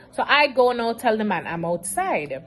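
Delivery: normal talking voice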